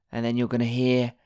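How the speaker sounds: usual speaking voice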